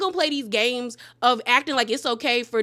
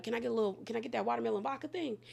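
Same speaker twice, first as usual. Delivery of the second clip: sneaky quiet drink order; 11 dB quieter, much faster than usual. Retold or imitated. Garcelle sneaks a drink at the bar.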